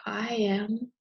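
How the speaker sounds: usual speaking voice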